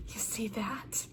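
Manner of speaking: whispered menacing taunt; retold whisper. Reviewer retelling the villain's taunt to Osha, whispered. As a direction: breathe it out as a hushed threat.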